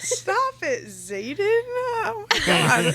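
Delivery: very high, laughing fangirl whine; swooning protest. Real, not acted. Fan begging Xaden to stop, high giddy swoon. Overcome by the book's romance.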